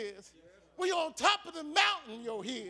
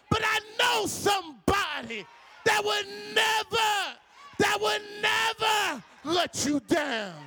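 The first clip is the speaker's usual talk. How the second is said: preaching at full force; 4 semitones higher than usual. Acted. Pastor urging the church not to depend on people, preaching at full force. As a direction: drive it home to the congregation, full voice.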